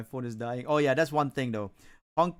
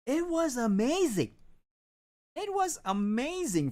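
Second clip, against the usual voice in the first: excited; 12 semitones higher than usual. Real, not acted. Raving about the game.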